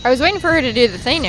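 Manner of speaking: excited rush. Real, not acted. Excited burst about a partner.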